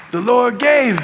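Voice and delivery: hammed-up revival preaching; fired-up preacher. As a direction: call it out to rouse the congregation. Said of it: "he'd get all fired up".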